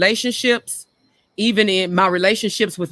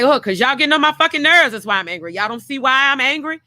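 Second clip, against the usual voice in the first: higher, fast mock-exasperated outburst; angry retort; 6 semitones higher, faster than usual. Mother answering kids who ask why she's so angry, retold angry retort. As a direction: re-enact a fed-up mother's testy comeback. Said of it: "that would make me angry".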